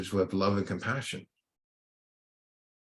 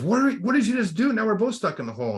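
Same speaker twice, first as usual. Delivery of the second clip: much higher and louder, caught off guard; shocked disbelief; 12 semitones higher, 4 dB louder than usual. Retold or imitated. Stuck man to the figure who jumped in, shocked and much higher. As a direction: blurt it out in alarmed disbelief, higher.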